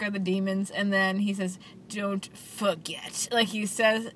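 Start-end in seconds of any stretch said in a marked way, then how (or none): 1.9-3.3 very slow, every word spaced out; clipped word by word. Retold or imitated. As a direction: say each word like it ends in a period. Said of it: "like there's a period after every letter".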